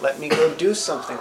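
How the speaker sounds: ordinary talk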